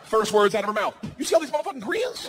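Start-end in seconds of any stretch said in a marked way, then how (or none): 1.2-2.3 high, fast imitation of an indignant woman; outraged disbelief. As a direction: blurt it high and quick, scandalized. Acting outspoken woman's first words.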